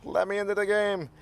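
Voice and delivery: high, pinched little goblin begging to get in; squeaky goblin voice. Retold or imitated. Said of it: "being like".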